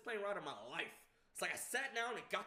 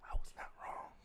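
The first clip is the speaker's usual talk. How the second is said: defeated whisper. Real, not acted. Admitting to the friend that his fear was right, defeated whisper. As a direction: let it fall out in a hush. Bitter result of his hope.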